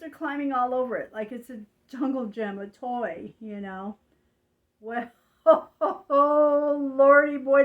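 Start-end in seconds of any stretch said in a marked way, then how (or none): 4.8-7.6 loud, high laughing outburst; laughing exclamation. Real, not acted. Telling how the kid climbed their car, loud laughing exclaim. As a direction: burst out with a laugh, then exclaim.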